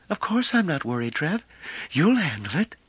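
high-pitched, unworried reply; carefree woman's voice. A narrator's reading. Narrator voices carefree Bliss, toes wriggling.

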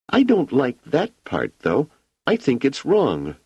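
put-on high child voice, sure of herself; a girl pointing out a mistake; firm little girl. A narrator's reading. Wanda tells Hugo the equation is wrong, firm and childlike.

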